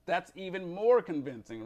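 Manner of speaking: ordinary delivery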